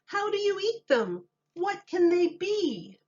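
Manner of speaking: a high, wondering voice; high townsfolk voices. A narrator's reading. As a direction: ask it like a crowd of curious townsfolk. Townspeople puzzled chorus.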